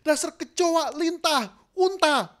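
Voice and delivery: loud, high furious name-calling; angry insults. Shown as a demonstration. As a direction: shout the insults easily. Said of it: "Wah, gampang banget".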